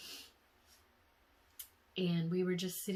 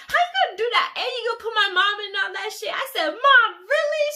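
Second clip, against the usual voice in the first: very loud and much higher scolding; indignant yelling; 16 dB louder than usual. Retold or imitated. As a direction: hold them to account. Scolding for the prank.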